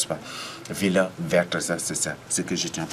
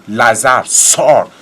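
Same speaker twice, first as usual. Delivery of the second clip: loud preacher calling Lazarus out; forceful, booming summons in prayer; commanding shout; 2 semitones higher, 10 dB louder than usual. Acted. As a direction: thunder it as a command to rise. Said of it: "comme il a dit".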